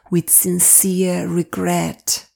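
normal talking voice

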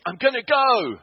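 shouting the decision alone in the parked car; shout at the top of voice. Retold or imitated. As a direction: bellow the decision out. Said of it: "shouted at the top of my voice".

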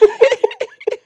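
high giggle; short stifled laugh; snickering. Real, not acted. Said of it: "Why are you snickering like that?".

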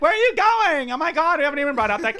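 high, loud, gushing party-guy imitation; excitable fun guys. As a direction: squeal it like two scandalized party guests.

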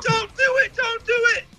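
high whiny mock crowd pleading; mock high-pitched bystanders. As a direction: mimic onlookers begging in a high voice.

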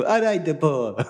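lifted, playful fake Italian greeting; mock Italian accent. Asking Nico to be Italian for him, mock Italian accent. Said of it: "you could be Italian for me".

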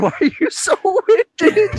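laughing quote. Retold or imitated. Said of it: "Meg said".

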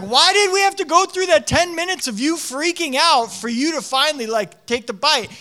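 high, loud, incredulous complaint; exasperated dad. Retold or imitated. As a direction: plead for an explanation, fed up. Dad's exasperated thought at tantrum.

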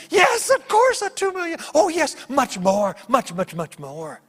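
high gleeful cry, easing down and slowing; exuberant angel. Retold or imitated. Angel answering pastor's guesses, giddy. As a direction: start in a loud squeal, settle into emphatic repeats. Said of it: "The angel was just laughing like crazy".

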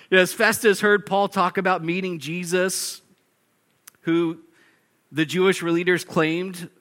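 ordinary delivery

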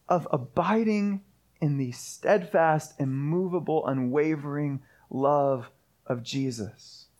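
slow, hushed awe. Real, not acted. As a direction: draw it out softly, slow and reverent. Climax of the worship point.

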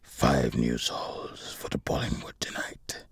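very low, slow whispered mutter; muttered under breath. A narrator's reading. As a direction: intone it darkly under your breath. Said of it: "he muttered under his breath".

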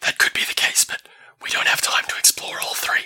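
hushed whisper voice; breathy urgent whisper; whispering Elva. Acted.

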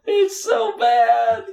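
high, slow groan of disgust; drawn-out dismay. Real, not acted. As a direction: stretch it out in disbelief. Reaction to the commercial.

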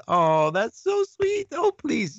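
mock gushing. Real, not acted. Reacting to viewer compliment.

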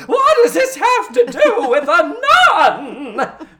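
very high, slow, hammed-up yell; mock outraged shriek. Recapper as Missus Garrett on the phone, mock outraged shriek. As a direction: stretch it into a warbling, incredulous shriek.

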